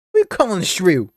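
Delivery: loud, high, offended Australian retort; an Australian character taking offence at an insult; indignant Australian accent. Acted. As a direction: snap back in an Aussie accent. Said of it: "Why am I giving them the accent?".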